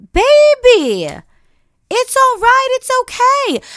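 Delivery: high, loud sing-song brush-off; mock sweet dismissal. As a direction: pat them on the head and dismiss them. Brushing off someone who falls short.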